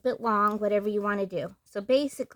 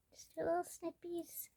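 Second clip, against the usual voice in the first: cutesy high voice; 8 semitones higher, 12 dB quieter than usual. Real, not acted. Cutesy word while cutting.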